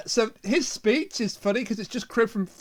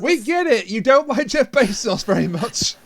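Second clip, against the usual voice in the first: louder, slow exasperated complaint; 4 semitones higher, 6 dB louder than usual. Real, not acted. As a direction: spell it out, fed up. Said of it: "still annoying the hell out of me".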